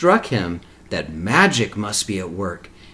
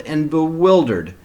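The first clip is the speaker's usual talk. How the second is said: usual speaking voice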